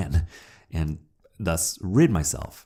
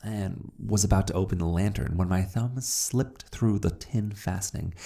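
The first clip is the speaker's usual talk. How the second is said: plain reading voice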